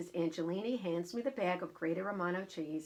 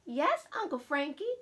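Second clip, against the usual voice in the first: put-on children's reply; 8 semitones higher, 4 dB louder than usual. Cousins answer in unison.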